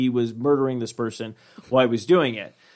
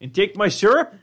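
mock Canadian; 5 semitones higher than usual. Acting a Canadian figure.

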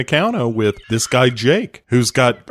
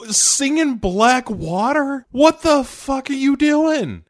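disbelief; 13 semitones higher, slower than usual. Real, not acted. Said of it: "outraged reaction".

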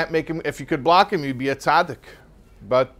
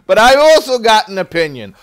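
loud, high, insistent retort; a loud good inclination butting in; Yetzer Tov speaking up; 7 semitones higher, 9 dB louder than usual. Retold or imitated. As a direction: push back hard and proud. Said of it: "who's gonna come and say".

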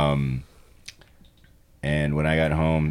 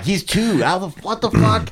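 baffled disbelief; 12 semitones higher, 4 dB louder than usual. Retold or imitated. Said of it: "I'm like".

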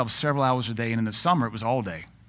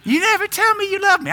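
mock wife; 7 dB louder than usual. Wife complaining in acted quarrel.

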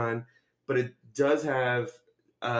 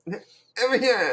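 slow, high squeal through laughter; mock excited reaction; 8 semitones higher, much slower than usual. Co-host echoing her friend's reaction, mock excited squeal. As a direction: stretch out an overdone fangirl squeal. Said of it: "That was my exact reaction".

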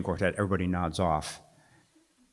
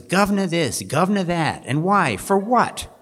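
high, loud mock Cockney whine, words thrown out in disgust; mocking wife's Cockney; 10 semitones higher, 6 dB louder than usual. Tom mimicking his wife's Cockney practice, high and loud. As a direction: ape her Cockney lines with exasperation. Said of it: "she just goes on and on".

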